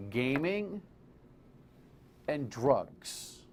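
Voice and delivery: GAMING lifted and hung, then a pause before DRUGS; GAMING rising, long pause, DRUGS dropped with weight; pointed emphasis. Real, not acted. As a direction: set out "gaming ... and drugs" as two loaded items. Punchline said for listener to repeat.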